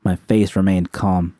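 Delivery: plain reading voice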